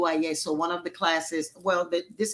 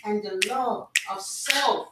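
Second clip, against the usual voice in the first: steady chanted prayer cadence over the group; 3 semitones higher than usual. Acted. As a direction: lead the circle in prayer, call and response.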